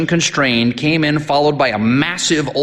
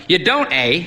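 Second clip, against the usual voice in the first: put-on high, booming old priest; hearty old priest; 7 semitones higher than usual. A narrator's reading. Old priest sizing up the sick boy, hearty. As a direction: boom at the boy like a brisk priest.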